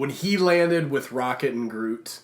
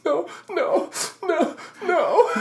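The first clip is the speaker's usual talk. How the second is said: much higher whiny no's, half laughing; mock anguished pleading; slower than usual. Girls in front reacting to Tony getting stabbed, mock anguish. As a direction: beg in imitated dismay. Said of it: "they're just like".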